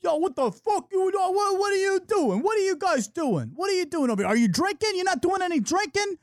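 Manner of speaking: put-on high, slurred-drunk heckling of everybody; drunk Philly girl. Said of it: "She was drunk. She's from Philly".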